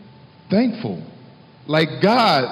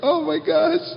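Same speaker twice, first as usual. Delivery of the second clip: mock gasp; 11 semitones higher than usual. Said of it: "So I'm just like".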